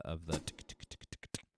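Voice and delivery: soft whispered tick tick imitating a hi-hat; imitated hi-hat ticks. Shown as a demonstration. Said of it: "create some variation in the volume".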